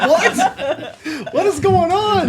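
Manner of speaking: very high, loud incredulous squeal through laughter; mock bewilderment. Host plays the baffled reaction to the caption, high mock shock. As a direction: cry out in exaggerated confusion, half laughing.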